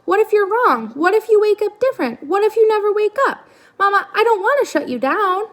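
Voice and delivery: high, anxious little bird voice; worried gosling. Acted. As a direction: panic rising like a scared child.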